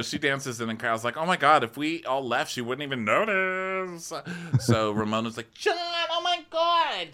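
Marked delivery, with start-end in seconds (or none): 1.2-4.1 mock scandalized aside, pitched up; gossipy imitation, voice rising; Kyle. Acting Kyle's aside about Ramona. 5.5-7.1 very high, drawn-out mock squeal; slow piercing caricature of delight; Ramona. Acting Ramona greeting John.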